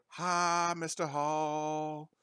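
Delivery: high, drawn-out friendly hello; cheery greeting. Retold or imitated. Former student greeting teacher Mister Hall at Walmart, high and cheery. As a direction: greet your old teacher brightly, stretching it.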